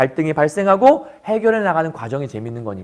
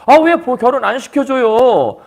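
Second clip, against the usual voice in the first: higher, louder whine, the end drawn out slowly; whiny complaint; 6 semitones higher, 5 dB louder than usual. Acted. Son-in-law whining to the father-in-law about the wedding. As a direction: nag like a frustrated son-in-law.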